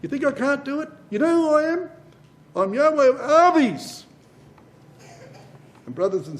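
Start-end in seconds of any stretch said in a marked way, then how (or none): 0.0-4.0 voice of Yahweh. Retold or imitated. God challenging doubters, higher and louder. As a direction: speak as God defying doubt. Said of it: "Over and over again".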